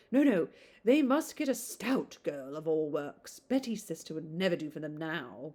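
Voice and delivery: ordinary delivery, nothing marked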